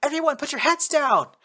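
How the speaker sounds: very high, loud scream of warning. Acted. Chihiro screaming as the chain gun opens up. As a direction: scream at them to get down. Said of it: "Chihiro screamed".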